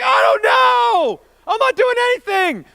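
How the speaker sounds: very high, loud acted protest; defensive yelling. Young man defending himself to his buddy, high defensive yell. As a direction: yell back, drawing the words out.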